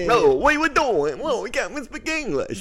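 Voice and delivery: high, loud hammed-up wiseguy voice; mock Italian-American guy. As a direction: play the clueless fake Italian to the hilt. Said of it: "acting out Italian Americans".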